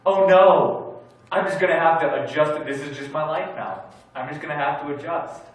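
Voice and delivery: a preacher mocking his own sick-day despair; mock panic. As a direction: act out sick-day despair, settling into gloomy acceptance. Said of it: "I started thinking these thoughts like".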